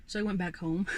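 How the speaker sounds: normal talking voice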